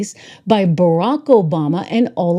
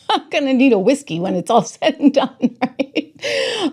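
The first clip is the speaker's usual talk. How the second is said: laughing at herself; 6 semitones higher than usual. Real, not acted. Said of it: "I'm getting my Irish up".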